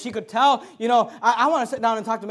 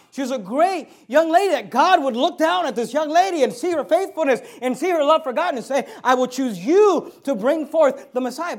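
loud, raised, emphatic preacher voice; full-force preaching; 5 semitones higher, 7 dB louder than usual. Real, not acted. Preacher exalting Mary's faith to the congregation, full-force preaching. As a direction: preach it out loud and high, landing hard.

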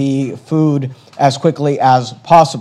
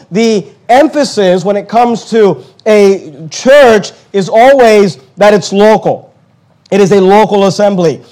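louder and higher preaching, building up; preaching at full force; 4 semitones higher, 4 dB louder than usual. Acted. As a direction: drive the point home to the congregation.

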